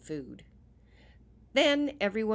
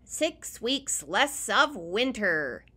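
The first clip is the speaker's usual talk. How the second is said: slow rabbit voice; 5 semitones higher, 8 dB louder, slower than usual. Acted. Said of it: "said Reginald Rabbit".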